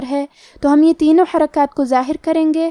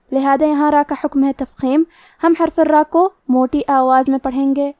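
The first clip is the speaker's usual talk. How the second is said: normal talking voice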